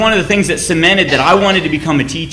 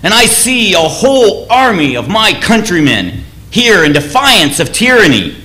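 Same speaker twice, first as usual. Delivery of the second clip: rally frightened soldiers from horseback; declaiming a defiant war speech to the army; Wallace rallying troops; 5 semitones higher, 3 dB louder than usual. Retold or imitated. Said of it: "And he says".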